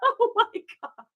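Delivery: high, breathless laughing through the words; helpless laughter. Real, not acted. Cracking up at her old title.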